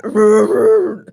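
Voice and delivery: loud, rolling imitated growly bark; deep dog bark. Owner imitating dog barking through the blinds, deep bark. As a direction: do a big dog's bark. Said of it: "a really deep bark now".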